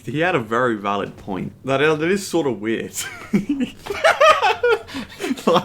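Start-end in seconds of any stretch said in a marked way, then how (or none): 3.0-5.4 high laugh. Real, not acted. Laughing at the story.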